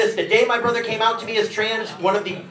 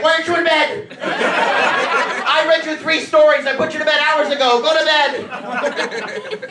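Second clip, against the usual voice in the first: put-on higher, slow, weary scolding of a child; exasperated dad; 7 semitones higher, 4 dB louder, slower than usual. Dad scolding a kid who won't sleep, exasperated. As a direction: send the kid back to bed. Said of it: "I'd be like".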